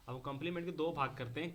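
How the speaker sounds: normal talking voice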